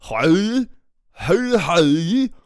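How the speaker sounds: high, loud Danish "hej"; Danish greeting. Retold or imitated. Streamer imitating Danish people he has heard, exaggerated Danish greeting. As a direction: belt out a caricatured Danish greeting. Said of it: "a typical Danish greeting".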